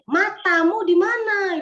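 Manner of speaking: loud, high, slow and blunt; blunt Medan-style yell. Retold or imitated. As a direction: snap it out like a Medan husband. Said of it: "kalau orang Medan, dia bilang gini". (bentakan keras khas Medan)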